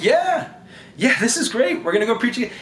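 high-pitched eager cheer; excited followers. Acted. Followers answering Jesus about preaching in Jerusalem, excited. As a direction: play thrilled followers, pitch jumping up. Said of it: "they're all excited".

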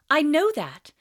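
defensive schoolboy; young Harry, defensive. Acted. Harry voiced in read-aloud.

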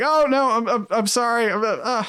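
high, stammering mock apology; flustered director. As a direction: imitate a director backing down in a fluster. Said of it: "the director is just like".

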